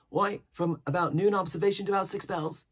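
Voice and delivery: an odd, jittery hermit rattling on; eager old castaway. A narrator's reading. Ben Gunn voiced in reading.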